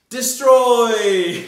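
big booming shout of the word; playful booming shout; dramatic shout. Pretend. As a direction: shout it like a villain.

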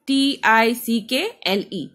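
टी आई सी के एल ई spoken letter by letter, slowly; spelling out letters. Shown as a demonstration. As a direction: spell टी आई सी के एल ई one letter at a time. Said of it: "spelling को divide करेंगे". (धीरे-धीरे अक्षर बोलना)

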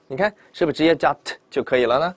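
normal talking voice

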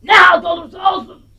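put-on shouts it at the child, fed up; angry yell. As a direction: bark it out, irritated. Grandmother snaps at child. (öfkeli bağırış)

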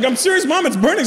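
put-on high-pitched kid pleading with mom; high, hammed-up childish protest; insisting child. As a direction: imitate an urgent, complaining son. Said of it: "I'm like".

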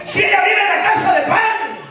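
rally the congregation with full force; fiery preaching. Acted.

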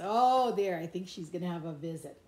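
ordinary delivery, nothing marked

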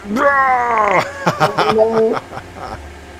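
high, loud burst of laughter; loud laughing shout. Real, not acted. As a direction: crack up with a yell. Laughing after missing.

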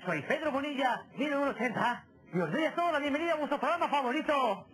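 upbeat show host intro. Acted. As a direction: announce it like a game show host. (presentador de televisión)

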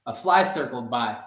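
ordinary delivery

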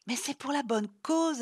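insistance butée, plus aiguë et forte. Acted. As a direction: insister comme une petite têtue. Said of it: "insista Chloé avec son petit air buté". (Chloé insiste, butée et plus aiguë)

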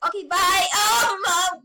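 ordinary talk

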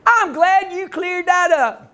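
slow, high Texas drawl; Texas girl. Retold or imitated. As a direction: draw it out like a girl from Dallas, Texas. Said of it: "Dallas, Texas".

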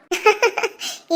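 soft, short laugh; chuckle. Acted. Mother describing her crying baby, soft laugh. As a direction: chuckle fondly, softly.

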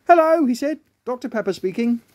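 high, singsong phone greeting; answering the phone. Acted. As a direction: pick up the phone brightly as the doctor.

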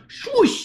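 swooping high whoosh; high swoosh effect. Acted. Sound effect for jumping bear.

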